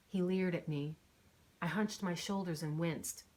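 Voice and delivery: usual speaking voice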